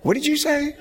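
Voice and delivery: high squeaky question through laughter; mock his wife's amused shock; laughing disbelief. Wife laughing at his slip on tape.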